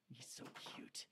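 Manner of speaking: soft whispered aside. Real, not acted. Owner whispering to viewers about her cute dragon. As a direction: breathe it out, barely voiced.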